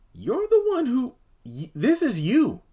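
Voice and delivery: high-pitched, disbelieving call-out; squeaky exasperation, acting out a confrontation; exasperated confrontation. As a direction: pitch jumps way up, accusing. Mock confronting Andy in a retelling.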